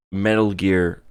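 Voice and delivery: ordinary delivery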